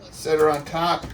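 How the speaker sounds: normal talking voice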